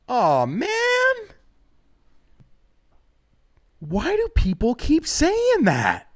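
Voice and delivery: high, slow whine; whiny. Retold or imitated. Comic whining down to the insulting man, high and slow. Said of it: "And I go".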